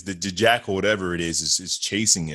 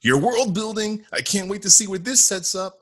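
mock eager voice; 11 semitones higher, 4 dB louder than usual. Imagined thought about Marvel.

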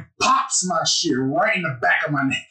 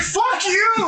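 high-pitched mock protest; retold indignant outburst. Concussed player answers the finger test, high mock outrage. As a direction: curse back in exaggerated outrage, voice way up.

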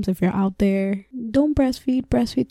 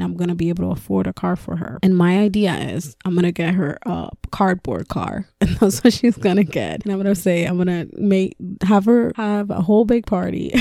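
usual speaking voice

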